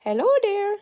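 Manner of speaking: high squeaky loud greeting; voicing the stitch. Crocheter voices a stitch greeting her, squeaky high call. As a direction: give the stitch a tiny eager voice calling out. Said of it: "It's like".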